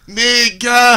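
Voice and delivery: drawn-out ending. Retold or imitated. Mimicking a boy practicing his word ending, slowly drawn out. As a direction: draw the last word right out, as if practicing it. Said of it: "drew it out".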